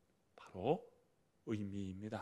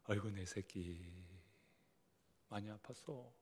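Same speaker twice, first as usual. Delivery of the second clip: tender mother; 3 semitones lower, 9 dB quieter than usual. Acted. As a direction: soothe a hurt child. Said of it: "그러면 엄마는 어떻게 해요?". (다친 아이를 달래는 엄마처럼 부드럽게)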